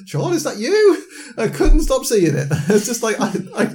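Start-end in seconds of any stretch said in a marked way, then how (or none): 0.1-1.0 higher, giggling through the words; playful mock recognition through laughter; laughing mock question. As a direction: squeak it out while cracking up. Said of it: "I couldn't stop singing".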